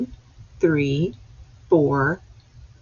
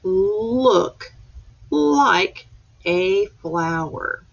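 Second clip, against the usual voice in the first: slow read-aloud; much slower than usual. Shown as a demonstration. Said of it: "So it says".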